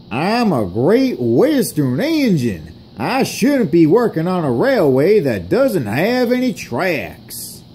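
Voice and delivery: put-on high, swooping southern accent. Engine grumbles about the railway in a southern accent. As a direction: complain in a broad southern accent. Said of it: "why are you speaking with a southern accent".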